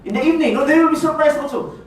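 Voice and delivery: ordinary talk, nothing marked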